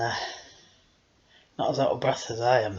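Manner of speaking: ordinary delivery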